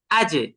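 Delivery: আজে as "aj" with the j bounced; qalqalah. Shown as a demonstration. Teacher models qalqalah on Jim, j of আজে echoed. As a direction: stop on the j of আজে and jolt it into a short echo. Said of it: "জিমটাকে কিভাবে কলকলা করব".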